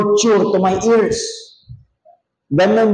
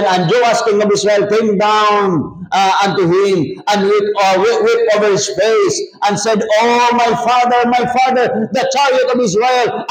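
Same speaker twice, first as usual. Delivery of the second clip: scripture reading; 6 semitones higher than usual. Acted. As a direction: read the Bible verse with mild solemnity. Reads scripture aloud. (pagbasa ng Kasulatan nang bahagyang mas mataas)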